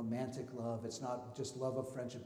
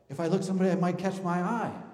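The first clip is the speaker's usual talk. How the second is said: mock nervous congregation; 4 semitones higher, 9 dB louder than usual. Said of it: "You're all like".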